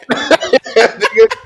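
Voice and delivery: very high, laughing squeal; laughing hard. Real, not acted. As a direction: crack up while getting the words out. Cracking up over DVD deals.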